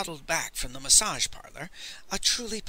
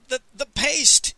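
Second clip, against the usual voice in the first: high, loud, slow stammer; startled, halting exclamation; gasped; 10 semitones higher, 7 dB louder, slower than usual. A narrator's reading. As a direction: gasp it out in alarm. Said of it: "he gasped".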